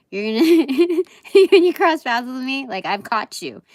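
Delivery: high, loud, laughing mock threat; gleefully playing the trap that catches you; laughing menace. Tarot reader voicing a card's persona.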